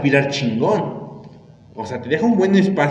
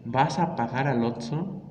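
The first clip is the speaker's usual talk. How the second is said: ordinary talk, nothing marked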